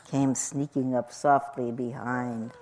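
low, soft narration; hushed, creeping storytelling; stealthy hush. A narrator's reading. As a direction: sneak through the words quietly. Hunters sneak up softly.

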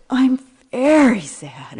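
put-on high, loud, slow wail of a sad toddler; child wailing sad. Grandson crying after spinning stops.

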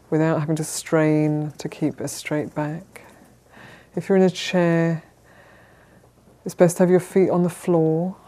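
calm, measured meditation guidance with long pauses; unhurried, even instructions, leaving space to settle; guided meditation. Real, not acted. Teacher settling a group into sitting posture, calm guided meditation.

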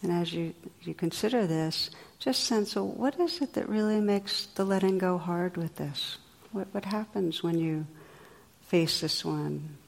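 soft, gentle meditation guidance; quiet reflective questions with lingering pauses; guided reflection. Real, not acted. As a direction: ease listeners inward, leaving long silences.

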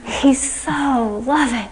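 slow, dreamy and gushing; swooning. Retold or imitated. Student after her interview, swooning over the teacher. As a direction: melt over every word like she's smitten. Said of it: "she was just swooning".

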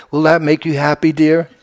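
a doting mother's mild question; sweet mother. Retold or imitated. Teacher recalling his mother's doting reply, mildly sweet. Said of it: "my mother would say".

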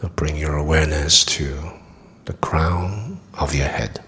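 calm meditation guide with long pauses; guided meditation. Acted. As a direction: guide them gently through the body, leaving long silences.

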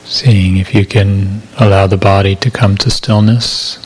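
calm, measured meditation voice with long silences; guided meditation. Acted. Meditation teacher settling listeners into body awareness, calm guided meditation. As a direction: guide listeners gently into body awareness, unhurried, evenly paced.

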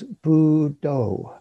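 "bu do" drawn out slowly, syllable by syllable; chant-like, stretched "bu" and "do"; meditation word demo. Shown as a demonstration. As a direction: stretch each syllable over a breath. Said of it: "Traditionally, you use".

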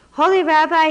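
high, loud desperate cry to the rabbi; poor man crying out for help. A narrator's reading. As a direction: call out to him in distress. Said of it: "he cried".

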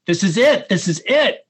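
mock dramatic teacher, insistent. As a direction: play it up like an emphatic Zen teacher. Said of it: "being a little bit dramatic".

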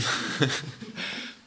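short breathy chuckle to himself; real, amused little laugh mid-thought. Real, not acted. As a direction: chuckle under your breath. Chuckles about his brother.

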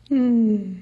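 ordinary delivery

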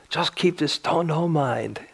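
put-on hushed Korean-accented Zen master; thick Korean accent. Zen master urging students to keep the teaching, thick Korean accent. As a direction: imitate a Korean master's English, half-whispered. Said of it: "thick Korean accent".

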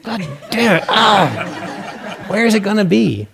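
hammed-up frustration of the failing meditator; laughing exasperation. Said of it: "Next day".

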